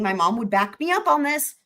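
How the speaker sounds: ordinary talk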